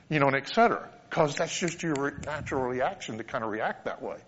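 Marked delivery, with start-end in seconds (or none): none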